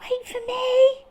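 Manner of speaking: high, small squeaky call; tiny Dormouse cry. Acted. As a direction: call out like a little mouse left behind. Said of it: "cried Dormouse".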